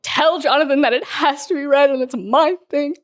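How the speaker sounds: hammed-up jealous complaint to her friend; mock emotional. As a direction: play hurt over a stolen book pick. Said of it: "I'm getting emotional".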